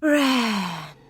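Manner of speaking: Wren sighed out on a long breath; exhaled Wren; slow exhale. Acted. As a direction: breathe the second syllable out slowly. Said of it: "exhaled slowly with the second".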